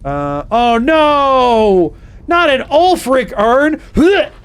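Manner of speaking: high, loud dramatic horror; mock dismay. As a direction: wail it like a tragedy. Mock dismay at Ulfric urn.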